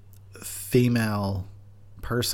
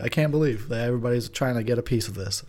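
usual speaking voice

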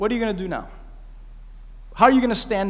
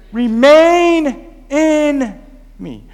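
"Remain in" stretched very slow and louder, then "me" drops; slow emphatic Remain; 3 semitones higher, 5 dB louder, much slower than usual. Acted. Pastor stressing the key verse to the church, slow and drawn out. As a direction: draw out "Remain in" like the heart of the sermon.

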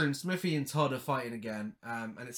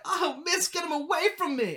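put-on high pleading child voice, much higher; pleading girl; 11 semitones higher than usual. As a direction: beg the teacher in a high girl's voice. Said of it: "And she's like".

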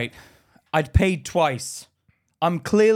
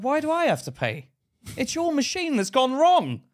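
high-pitched acted indignation; indignant protest; 10 semitones higher than usual. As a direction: replay an aggrieved customer's complaint. Customer protests at paying twice.

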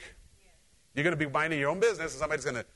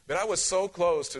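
usual speaking voice

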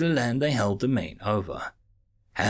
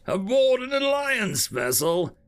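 can't believe his ears; incredulous; 10 semitones higher, 4 dB louder than usual. Acted.

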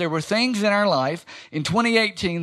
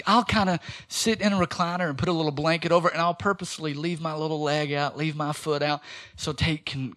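normal talking voice

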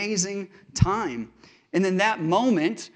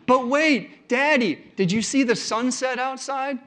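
put-on higher, pleading little-boy voice; imitating his little son; 7 semitones higher than usual. Father voicing his son begging to stay up, high and pleading. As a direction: play the kid stalling bedtime, voice up high.

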